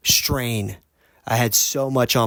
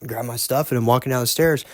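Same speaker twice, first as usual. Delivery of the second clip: usual speaking voice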